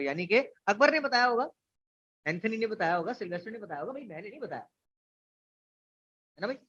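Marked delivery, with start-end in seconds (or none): none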